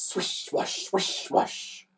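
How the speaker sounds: whispered, rhythmic swishing; wiper swishes. A narrator's reading. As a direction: hiss the words like sweeping wipers. Narrator imitates windscreen wipers.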